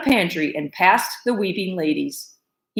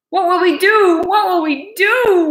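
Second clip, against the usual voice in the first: wailing; 11 semitones higher, 5 dB louder than usual. Acted. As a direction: wail in despair. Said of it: "wailed Cook".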